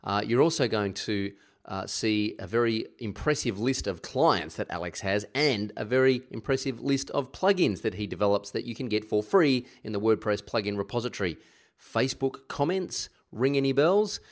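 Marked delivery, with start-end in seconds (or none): none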